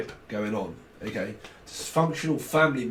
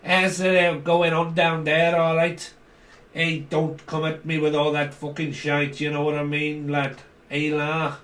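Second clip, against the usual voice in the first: put-on Scouse, pitched up at first; Scouse accent; 2 semitones higher than usual. Shown as a demonstration. Showing Americans the Scouse accent, put-on Scouse. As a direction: do a Scouser's greeting and warning. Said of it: "that may not have heard the Scouts accent".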